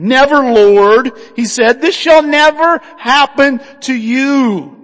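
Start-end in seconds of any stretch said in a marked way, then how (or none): 0.1-1.1 loud, drawn-out protest; rebuking. Acted. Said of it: "began to rebuke him". 1.8-4.8 loud, slow, raised-pitch protest; rebuking. Acted. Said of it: "began to rebuke him".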